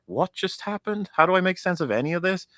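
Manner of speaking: high, stunned questions; reliving the twist, baffled; mock bewilderment. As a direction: sound utterly lost. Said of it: "I was just like".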